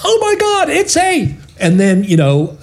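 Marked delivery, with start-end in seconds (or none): 0.0-1.3 high-pitched radio-play gasp of horror; exaggerated horrified reveal, pitched way up; mock shock. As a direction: play a hammed-up startled discovery. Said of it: "and then we could go".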